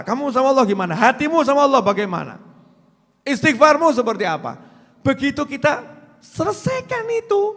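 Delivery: forceful preaching. Acted. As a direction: drive each question into the congregation. (ceramah lantang penuh semangat)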